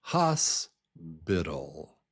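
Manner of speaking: "hospital" slowly as HOS, pause, low biddle; split into syllables. Shown as a demonstration. Teacher breaks the word down, "hospital" in two pieces, HOS strong. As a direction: stretch HOS, then drop to biddle in "hospital". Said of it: "The first syllable is strong".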